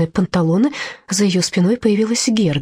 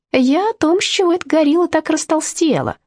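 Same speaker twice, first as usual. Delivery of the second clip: put-on boy's voice; 11 semitones higher than usual. A narrator's reading. Boy voiced high.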